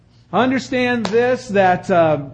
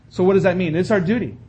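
ordinary talk

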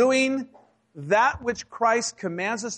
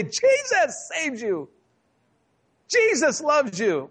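high-pitched, drawn-out proclamation; emphatic mock blessing; 6 semitones higher, slower than usual. Preacher answering someone's sneeze, emphatic mock blessing. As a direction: sing it out slowly, high and big.

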